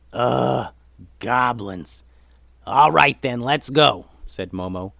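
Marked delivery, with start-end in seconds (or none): 0.1-2.0 exasperated groan and grumble; groan, then grumble the word with distaste; grudging disgust. Acted. Momo reluctantly accepting goblin help, grudging. 2.7-4.1 loud, decisive call to move; resigned command. Acted. Momo agreeing to follow the goblins, loud command. Momo orders the group onward.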